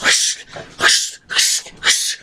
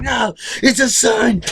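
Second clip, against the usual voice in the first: much higher, lightly voiced frantic father; a hint of a panicked dad; mock desperate Rick; 9 semitones higher than usual. Retelling Rick refusing to let go, high and frantic. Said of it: "and he's like".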